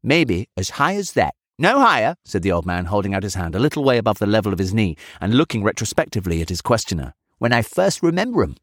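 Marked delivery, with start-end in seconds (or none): 0.0-2.2 put-on reminiscing old man. A narrator's reading. Old man recalls childhood to the chemist, high aged voice. 7.4-8.6 high, piping aged voice; reminiscing old man. A narrator's reading. Old man remembers a childhood Christmas, high reminiscing voice.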